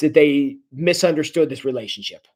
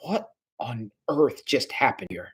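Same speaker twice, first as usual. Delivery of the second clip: lower, softer bewildered murmur; stunned murmur; 4 semitones lower, 9 dB quieter than usual. Retold or imitated. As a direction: say it as if the floor just dropped away. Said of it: "Craig is left going".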